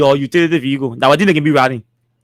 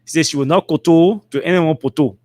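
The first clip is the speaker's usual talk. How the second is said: ordinary delivery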